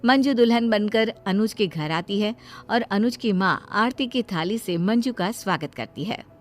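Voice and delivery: ordinary delivery, nothing marked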